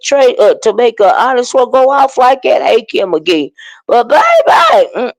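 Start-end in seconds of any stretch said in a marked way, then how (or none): 3.9-5.1 exclaiming. Real, not acted. Gossiping to chat, exclaiming.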